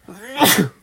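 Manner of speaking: sharp loud cough; a real chesty cough. Real, not acted. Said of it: "I am full of a cold".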